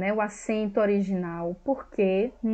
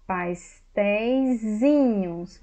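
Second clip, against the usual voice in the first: Pastéisinhos drawn out at a steady pitch; slow demo word; much slower than usual. Shown as a demonstration. Teacher first showing the diminutive plural, slow. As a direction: sound out Pastéisinhos slowly. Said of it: "colocar no diminutivo, plural".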